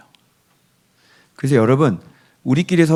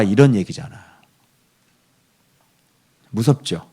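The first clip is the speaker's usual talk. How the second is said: usual speaking voice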